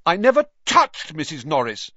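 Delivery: loud, high protest of innocence. A narrator's reading. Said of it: "Harry said loudly".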